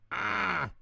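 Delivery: high playful shriek at the monster; mock scared yell. Divers startled by the pretend monster, high mock yell. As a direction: jump with pretend fright. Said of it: "yelled Pip and Pop".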